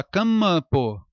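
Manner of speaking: "campo" com M de consoante, ca-ma-po; pronúncia errada. Shown as a demonstration. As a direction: solte o M de "campo" numa sílaba própria. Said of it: "ou fala campo". ("campo" com "mâ" no meio)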